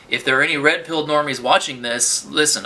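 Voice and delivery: normal talking voice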